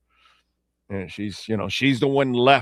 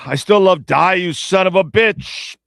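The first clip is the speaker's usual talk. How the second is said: loud, high-pitched hero's outburst; movie-climax yell; 6 semitones higher, 7 dB louder than usual. Acted. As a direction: yell it like the movie's final showdown. Acts out Jaws movie's climactic line.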